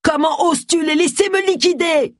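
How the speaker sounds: high, strained yell of outrage; acted furious cry at moderate volume; outraged yell. A narrator's reading. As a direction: rage at a betrayal, pitch way up. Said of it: "une voix d'homme qui hurlait".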